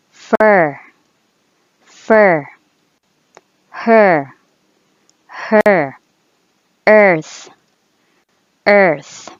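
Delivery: stressed er vowel in each word; er sound. Shown as a demonstration. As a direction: lean on the er. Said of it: "we could simply say er".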